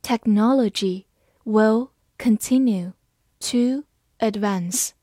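slow, word by word; deliberate teaching pace; slow reading. Shown as a demonstration. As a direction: read it slowly for learners. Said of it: "好，慢慢来读。".

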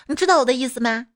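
ordinary delivery

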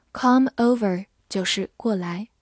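ordinary delivery

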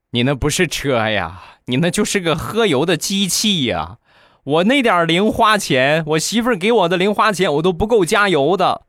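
a lightly voiced griping brother-in-law; whiny brother-in-law. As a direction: moan about the fuel bill. Brother-in-law complains about fuel.

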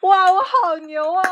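slow, high-pitched crowing at oneself; delighted self-praise. Real, not acted. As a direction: draw it out high, wowed by yourself. Boasts after guessing correctly.

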